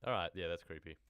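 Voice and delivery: usual speaking voice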